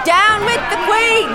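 shouting. Acted. Shouting in crowd disguise.

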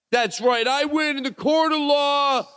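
high, grand award speech; mock acceptance speech. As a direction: thank the crowd like a winner.

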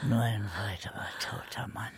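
low, fast whispered threat; menacing whisper. Acted. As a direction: hiss the threat under the breath. Old man's threat fading out.

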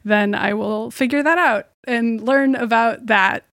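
normal talking voice

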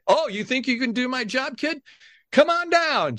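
high showman's challenge, stretching the call; imitated game-show host. Host challenging a kid in the audience, showman's call. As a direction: play the host calling a contestant up.

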